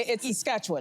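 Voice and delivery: Saskatchewan squeezed to sas-KATCH-wun; local Saskatchewan. Retold or imitated. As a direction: quote the locals' short-ended Saskatchewan. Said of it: "you say Saskatchewan".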